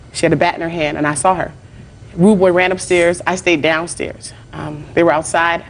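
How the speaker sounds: ordinary talk, nothing marked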